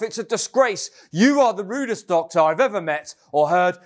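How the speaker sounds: high, indignant storming tirade; offended patient scolding her doctor, high and huffy; indignant rant. A narrator's reading. First patient rants at doctor.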